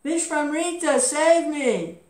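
high, loud cry for rescue; screaming for help. A narrator's reading. Trishanku falls from heaven, screaming to Vishwamrita. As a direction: call out desperately, pitched well up.

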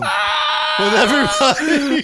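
slow, high exasperated yell; exasperated shout. Real, not acted. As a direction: shout it out in disbelief. Friends roasting him on stream.

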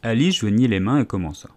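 usual speaking voice

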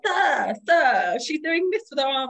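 high whiny sir, sir; mocking tattletale. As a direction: imitate an opponent telling on her. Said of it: "she's like".

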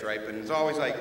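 ordinary delivery

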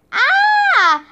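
short high-pitched scream; high, sustained yelp of fright; startled scream. A narrator's reading. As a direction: scream out high, in sudden alarm. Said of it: "I screamed".

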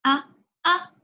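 short, separate "أ" with an a vowel; alif with fatha. Shown as a demonstration. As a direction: sound out "أ" as a brief glottal a, pausing between. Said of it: "حرف الألف مع الفتحة". (نطق "أ" بالفتحة مقطعًا قصيرًا)

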